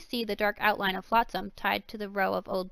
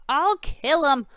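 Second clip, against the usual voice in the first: loud, higher, slow furious rant; 6 semitones higher, 10 dB louder, slower than usual. Acted. Loxy watching the deer escape, loud furious rant. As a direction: spit out the threat in a rage. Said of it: "Loxy ranted".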